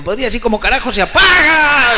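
grandma's high, loud indignant outburst; shouted punchline. Acted. The granny yells at the pharmacist, exasperated and loud.

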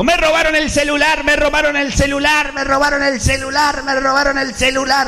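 high wailing cry of a robbed man; shouting man. Acted. Robbed man crying down the street, high wail. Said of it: "venía gritando un tipo".

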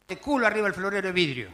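ordinary delivery

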